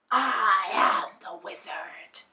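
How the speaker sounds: slow whispered hiss; hissing fire. A narrator's reading. Ball of fire hisses at the lion, slow. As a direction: hiss it slowly. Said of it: "hissed the ball".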